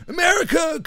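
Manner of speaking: very high, hammed-up holler; distant anthem parody; mock patriotic anthem. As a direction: belt it in a silly falsetto. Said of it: "you can hear the fucking American national anthem".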